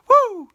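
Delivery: loud high whoop of delight; sudden joyful whoop. Real, not acted. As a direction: let out a big woo. Excited whoop mid-speech.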